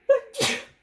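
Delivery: one sharp loud cough; a real unplanned cough; sudden cough. Real, not acted. Coughs right after a fake-out sneeze.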